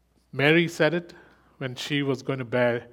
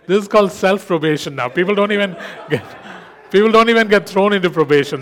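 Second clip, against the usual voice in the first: talking through laughter; loud laughing delivery; 7 semitones higher, 12 dB louder than usual. Real, not acted. Laughing at the class.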